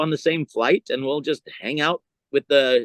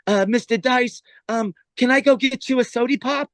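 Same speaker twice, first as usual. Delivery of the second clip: high-pitched put-on errand boy; hammed-up eager gofer voice; meek lackey; 8 semitones higher than usual. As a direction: play a servile assistant, pitched well up. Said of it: "So now Dice goes".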